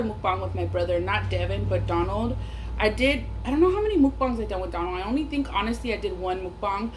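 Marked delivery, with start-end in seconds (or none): none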